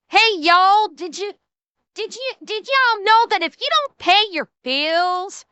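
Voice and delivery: put-on high, loud opening in a Southern accent. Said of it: "I'm doing a Southern accent".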